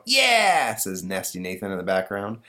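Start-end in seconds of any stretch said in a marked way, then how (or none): 0.1-0.8 put-on high, loud kid's yell; drawn-out backing shout; bratty cheer. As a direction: chime in like a gleeful brat. Bratty son cheers dad on.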